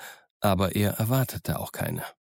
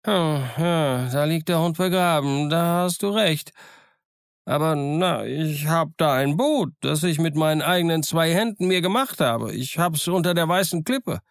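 higher, lively voice of a half-wild marooned sailor; higher-pitched, eager old castaway; eager castaway Ben Gunn; 7 semitones higher than usual. Acted. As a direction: play the odd, chattering island hermit, pleased with himself. Ben Gunn offers his boat.

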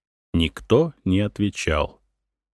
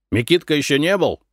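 mildly irritable, raised pitch; impatient, irritated; 8 semitones higher, faster than usual. A narrator's reading. Peasant husband questioning his wife on coming in, mildly impatient. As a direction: ask it with a touch of impatience. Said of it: "как-то нетерпеливо и раздражительно".

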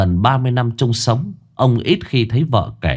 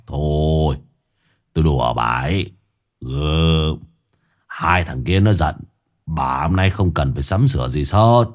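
husband's voice; 6 semitones lower than usual. A narrator's reading.

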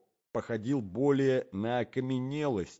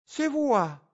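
very high, loud cry of delight; joyful cry; 14 semitones higher, 5 dB louder than usual. A narrator's reading. Lady rushes to her husband on the porch, joyful cry. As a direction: shriek a delighted greeting. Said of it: "закричала Глафира Петровна, с неподдельной радостью".

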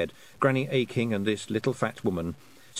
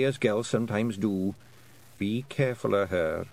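put-on higher Scots voice of a wee old woman; a sly old Scottish matriarch sniffing out a treat; lightly voiced old Scottish woman, teasing; old Scots Kelda; 4 semitones higher than usual. Kelda explaining clan ways.

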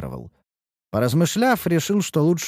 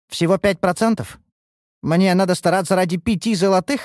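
higher-pitched haggling protest; indignant disbelief; 7 semitones higher, 3 dB louder than usual. Acted. Elf offered a small share, indignant. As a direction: question the offer as if insulted.

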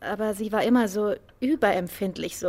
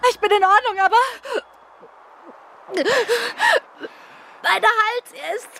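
high, breathless and frightened, words catching; a woman still reeling from being strangled, voice climbing in panic; terrified retelling of the attack, pitched high; shaken after attack; 9 semitones higher than usual. Acted. Victim just attacked, shaken.

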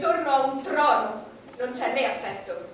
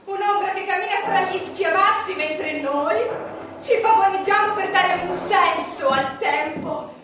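loud, higher, driven tirade; loud bitter declamation; 4 semitones higher, 7 dB louder than usual. Acted. Queen mocking the strutting court, loud bitter tirade. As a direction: pour out the scorn at full force. Queen scorning life's meaninglessness.

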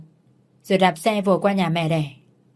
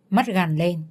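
plain reading voice

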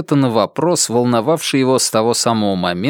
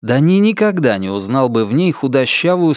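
plain reading voice

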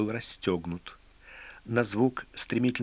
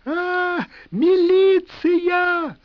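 high, slow, drawn-out scream; hysterical screaming; 9 dB louder, much slower than usual. A narrator's reading. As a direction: wail for the police in terror. Wife calls the police.

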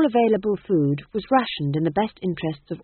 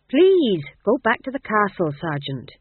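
high, loud please, then falling order; exasperated plea; 3 semitones higher, 5 dB louder than usual. Acted. King begging sergeant to leave, exasperated. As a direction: burst out on please, then settle.

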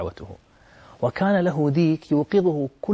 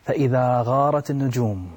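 ordinary talk, nothing marked

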